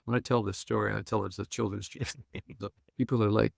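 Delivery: ordinary talk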